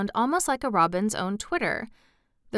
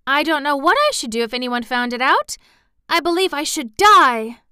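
loud, high, urgent declaration; quite fierce; 5 semitones higher, 8 dB louder than usual. A narrator's reading. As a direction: drive it out fiercely, punching the end. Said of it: "She said the last sentence quite fiercely".

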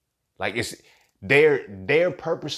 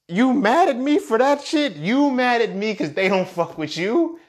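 higher, louder, slow teasing taunt; mocking taunt; 11 semitones higher, 5 dB louder than usual. Taunting the jealous nerd, slow and mocking.

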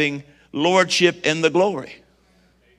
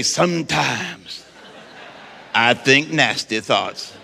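slow sly confession. Shown as a demonstration. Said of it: "Look at your neighbor and say".

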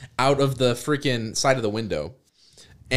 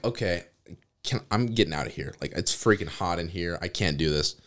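low grumble; 9 semitones lower, 6 dB quieter than usual. Retold or imitated. Groggy, overheated on the bus.

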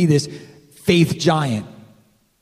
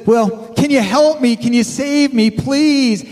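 higher and louder, begging; pleading hiker; 6 semitones higher, 4 dB louder than usual. Retold or imitated. Hiker on the branch begs God to save him, high pleading. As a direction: plead for rescue, rising. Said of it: "And so he says".

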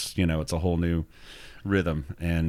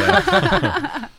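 high, louder laughing yeah; 5 dB louder than usual. Real, not acted. Laughs at his own schedule.

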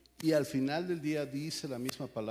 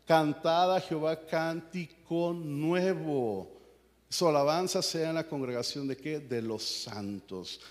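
solemn scripture reading; 3 semitones higher, 4 dB louder, slower than usual. Acted. Reading psalm aloud.

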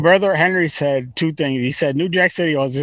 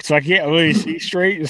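ordinary talk, nothing marked

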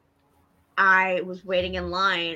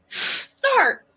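mock outrage; 10 semitones higher, 4 dB louder, much slower than usual. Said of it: "I'm like".

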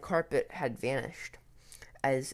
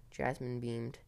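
usual speaking voice

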